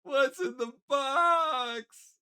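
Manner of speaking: slow, building to a crying yell; anguished shout. Pretend. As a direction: howl it out in despair. GM yells famous movie line.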